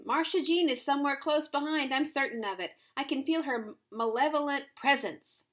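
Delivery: high, loud, dramatic warning; urgent shouting. A narrator's reading. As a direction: holler a warning from the car. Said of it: "shouted the voice".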